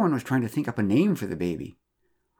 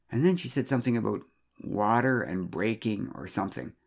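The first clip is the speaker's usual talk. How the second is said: plain reading voice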